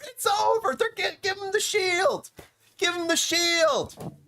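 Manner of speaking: high-pitched shouting, drawing out the last calls; over-the-top hype, way up high; excited proclamation. Real, not acted. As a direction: declare the title won in wild excitement. Hyped roster rant, declares it over.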